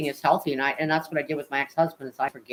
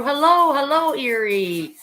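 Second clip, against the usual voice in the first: excited greeting; 8 semitones higher, 5 dB louder, slower than usual. Real, not acted. Greeting a chat viewer.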